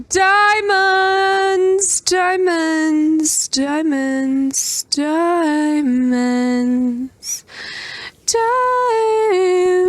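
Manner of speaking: joyful diamond chant; excited sing-song. Real, not acted. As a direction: sing out the find with glee. Finding diamonds, calling out.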